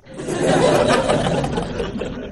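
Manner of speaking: high breathy laugh; rising airy laugh. Real, not acted. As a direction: laugh at the punchline. Laughs after punchline.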